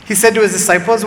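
usual speaking voice

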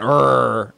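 rolled growl Rrr. Shown as a demonstration. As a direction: growl the r from the throat. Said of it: "and I went".

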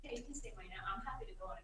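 faint, off-mic, barely voiced; far from the mic. Real, not acted. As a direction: say it away from the microphone. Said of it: "Did you hear what [name] just said?".

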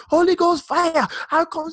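high, loud frantic African mom shriek; mother bursting in on her sick son, shrieking high and loud; mom going crazy. Retold or imitated. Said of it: "she went crazy".

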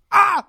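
short high-pitched mock scream; retold scream. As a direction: yelp suddenly, as if waking in terror. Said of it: "I scream".

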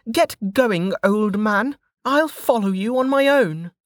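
put-on high-pitched brash young woman; Velika's voice. A narrator's reading. Velika scolding the downed sentinel, high and cocky. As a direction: toss it off, cocky and unbothered.

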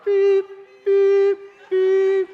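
high loud beeping like a backing truck; truck reverse beeps. Retold or imitated.

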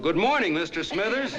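retold yell. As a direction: holler it as if to the next county. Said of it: "in a voice you could have heard in the next county, I yelled".

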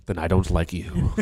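low, soft cold dismissal; cold father. Pretend. The father coldly rejects his son, low and soft.

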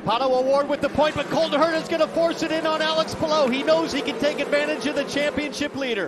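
fast, urgent race call; excited play-by-play. Real, not acted. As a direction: call the overtake rapidly. Race start, rapid calls.

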